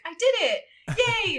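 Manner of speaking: high, loud mock-chipper cheer. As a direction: cheer perkily, imitating a delighted winner. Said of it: "like, chipper".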